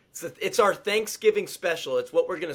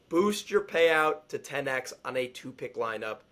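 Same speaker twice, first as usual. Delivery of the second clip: normal talking voice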